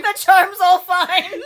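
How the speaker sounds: slow, high repeat through laughter; laughing. Real, not acted. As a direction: laugh while echoing the words. Friend echoes the flubbed line.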